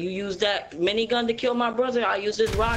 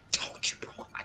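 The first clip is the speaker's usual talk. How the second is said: hushed aside whisper; 11 dB quieter, slower than usual. Acted. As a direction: drop to a whisper. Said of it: "I told you".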